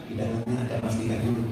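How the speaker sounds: very soft, quick hushed whisper; whispered secret. Retold or imitated. Preacher whispers the hidden gold to his friend, barely audible. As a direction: breathe it almost silently. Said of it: "Tapi saya bisikin".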